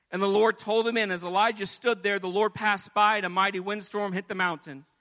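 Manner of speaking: ordinary talk, nothing marked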